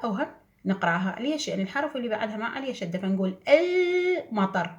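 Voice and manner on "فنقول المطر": المطر said slowly with a clear el- and no glottal stop; slow المطر, el-matar run smoothly together; careful المطر, definite article joined on; correct al- reading. Shown as a demonstration. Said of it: "ما نقول أمطر".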